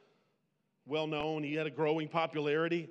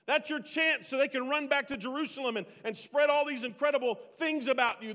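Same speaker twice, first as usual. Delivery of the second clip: louder and higher, slowed, pressing hard on chance; fervent loud exhortation; 6 semitones higher, 5 dB louder than usual. Acted. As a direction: call out to John like you're shaking him awake. Preacher urging John to seize chance.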